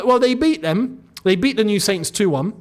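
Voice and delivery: usual speaking voice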